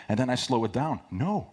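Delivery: ordinary delivery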